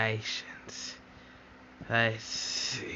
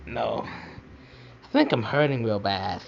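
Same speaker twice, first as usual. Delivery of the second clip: ordinary delivery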